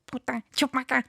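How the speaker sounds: giggly mock alien gibberish; high, laughing Stitch impression; Stitch alien phrase. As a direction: imitate Stitch's garbled alien speech through a laugh. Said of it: "I'm gonna try".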